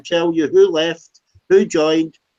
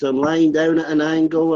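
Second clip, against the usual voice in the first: ordinary delivery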